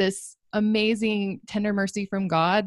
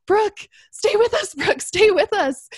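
high, loud urgent shout; retold shout; 11 semitones higher, 6 dB louder than usual. EMT shouts at the fading patient, loud and urgent. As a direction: shout to keep her conscious. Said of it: "the EMT just starts shouting at me".

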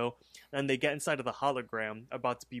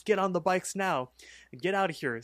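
higher-pitched mock urgent command; urgent order; 6 semitones higher than usual. Benson ordering his friends to flee, retold urgent command. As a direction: imitate a hurried escape order.

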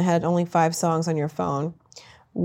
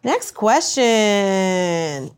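slow drawn-out; much slower than usual. Real, not acted. Moving on.

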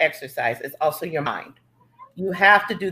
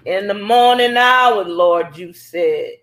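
churchy, laughing sermon call; mock preaching; 4 dB louder, slower than usual. Mock preaching after his grunt.

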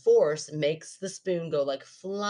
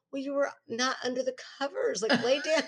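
mock exasperated; 10 semitones higher than usual. Retelling her talk to sleepwalking husband.

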